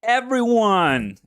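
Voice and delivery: high, loud, stretched-out call; drawn-out hype shout; big announcer call. Real, not acted. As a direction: announce it slowly to the whole crowd. Calls out to start the sign-off.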